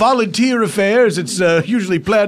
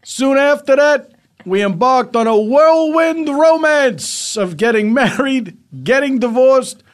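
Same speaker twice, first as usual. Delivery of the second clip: groom reading vows; 6 semitones higher, 3 dB louder than usual. Acted. Groom reads wedding vows.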